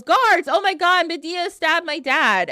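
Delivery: hammed-up yell for the guards; mock yell. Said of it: "then he yells".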